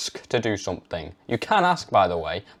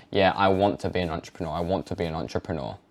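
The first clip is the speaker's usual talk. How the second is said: tentative claim; 6 semitones lower, 5 dB quieter than usual. Retold or imitated. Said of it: "I started saying".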